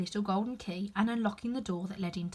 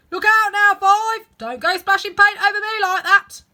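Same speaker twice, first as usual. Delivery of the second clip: high, loud yelling gardener; angry shout; 12 semitones higher, 15 dB louder than usual. Acted. Gardener yells at Five over paint, high and loud. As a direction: snap at him to stop splashing.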